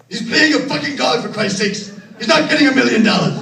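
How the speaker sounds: put-on high, loud furious yell of an old Hollywood producer; screaming producer. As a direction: bellow it down the hall like a raging producer. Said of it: "Harry screaming".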